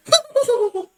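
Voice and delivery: very high, loud squeaky cackle; squeaking. Shown as a demonstration. Player demonstrates the comic Noel voice that made the table laugh, loud high squeaking. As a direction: let out a piercing squeal of a laugh. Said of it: "I hope that picked up that squeaking".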